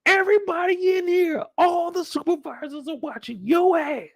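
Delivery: high, scolding boss voice; angry captain. Retold or imitated. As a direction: warn Burke everyone is watching. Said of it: "He said".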